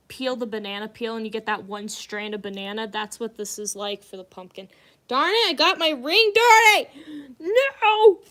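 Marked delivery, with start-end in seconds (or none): 5.1-8.2 much higher and louder cry cracking at the top; frustrated yelp. Real, not acted. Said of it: "I got a voice crack".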